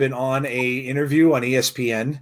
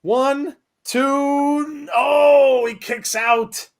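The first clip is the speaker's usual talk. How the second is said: rising excited play-by-play, higher; wrestling commentator; 8 semitones higher, slower than usual. Acted. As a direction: call the match live, building hype. Live play-by-play of the match.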